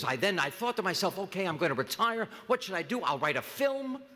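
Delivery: talking dog. Acted. Dog tells its life story.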